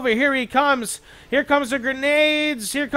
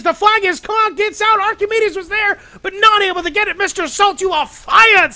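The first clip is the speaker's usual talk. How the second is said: higher and louder, building into a shout; excited shout; 7 semitones higher, 6 dB louder than usual. Real, not acted. Caster yelling as the flag gets conked, excited shout. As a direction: shout it out as the play breaks.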